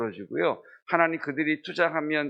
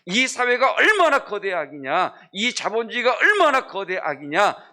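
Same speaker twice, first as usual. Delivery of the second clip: high, loud, building denunciation; preaching at full force, high and loud; fiery preaching; 9 semitones higher, 7 dB louder than usual. Acted. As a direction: shout it from the pulpit. Preaching climax against society.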